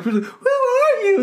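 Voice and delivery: very high, drawn-out squeal; mock alarmed victim. Victim questioning the muttering killer on the phone, high mock alarm.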